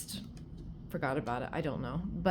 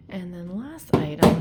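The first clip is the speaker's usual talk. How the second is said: ordinary delivery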